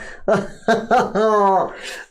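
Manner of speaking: amused laugh at being caught out; real laugh. Real, not acted. Host laughing at a viewer's joke, genuinely amused. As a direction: laugh at yourself for falling for it.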